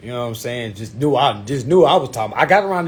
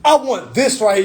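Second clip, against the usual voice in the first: high, punchy, confident demand; re-enacted chesty self-assurance; said with his chest; 9 semitones higher, 4 dB louder than usual. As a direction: state it boldly like a boss. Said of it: "I said that shit with my chest".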